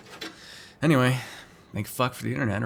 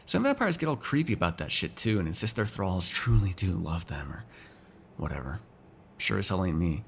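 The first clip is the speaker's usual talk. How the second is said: ordinary delivery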